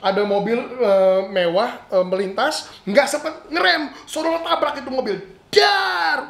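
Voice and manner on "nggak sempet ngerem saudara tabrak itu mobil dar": rising to a high shouted crash; crash narration. Acted. Telling how the bajaj driver hits a luxury car, excited. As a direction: shout the bang Dar as it hits.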